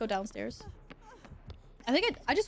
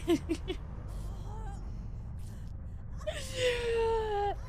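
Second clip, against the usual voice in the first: high delighted shriek of laughter; 9 semitones higher, 4 dB quieter than usual. Real, not acted. Player meeting a friend, shrieking laugh. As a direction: squeal with laughter.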